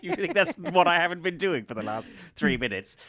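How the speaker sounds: exasperated. Real, not acted. Exasperated at stuck bottle cap.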